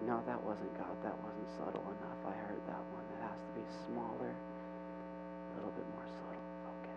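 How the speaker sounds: very low, fading to a whisper; hushed straining. Acted. People leaning in to hear God, hushed mock concentration. Acting strained listening for tiny voice.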